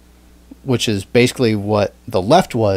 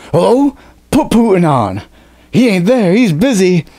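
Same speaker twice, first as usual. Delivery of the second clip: Trump on the phone; 9 semitones higher, 4 dB louder than usual. Retold or imitated. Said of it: "They're like".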